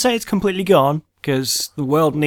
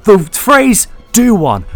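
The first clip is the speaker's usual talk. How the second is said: hammed-up outraged rant, higher and louder; angry rant; 5 semitones higher, 8 dB louder than usual. Comic ranting at co-hosts about a silly insult, hammed-up anger. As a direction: rage in exasperated bursts at a baffling phrase. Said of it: "what really angers me right now".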